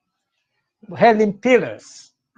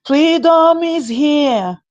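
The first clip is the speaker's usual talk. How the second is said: high, loud proclaiming cry; joyful shout; 9 semitones higher, 3 dB louder, slower than usual. Real, not acted. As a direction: shout it out to the crowd. Shouted line after chant.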